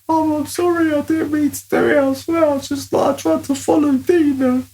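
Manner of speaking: high squeaky apology to mum; pleading kid voice. Retold or imitated. Mud-covered boy apologising to his angry mum, high and whiny. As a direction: beg forgiveness in a whiny little-boy voice.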